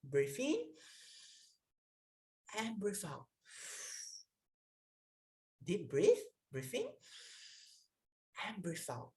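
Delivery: soft, unhurried breathing cue with long pauses; guided breathing. Shown as a demonstration. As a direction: lead the class through each breath. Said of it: "Let us do it together".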